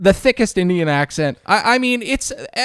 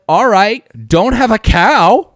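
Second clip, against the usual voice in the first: louder, higher brush-off; dismissive; 6 semitones higher, 9 dB louder than usual. Retold or imitated. Clerk brushing off the annoyed caller, louder dismissive. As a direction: wave off the complaint, mock breezy. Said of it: "his tone was like".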